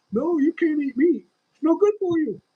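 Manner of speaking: high, loud mocking nag; mock warning voice.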